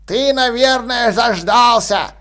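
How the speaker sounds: put-on slow mumbling old man; a toothless old ghost, slowly. A narrator's reading. Old man's ghost speaks.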